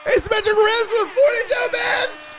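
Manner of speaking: very high, shouted donor thanks; hollered shout-out. Pretend. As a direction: holler the donations at the top of your pitch.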